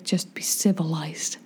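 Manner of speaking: low, soft, prim voice for the friend; a friend's low, prim rebuke; friend's prim reply. Retold or imitated. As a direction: quietly scold like a prim friend. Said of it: "And she's like".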